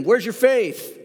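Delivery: a hint of Jesus challenging the frightened disciples; Jesus rebuking. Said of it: "he said to them".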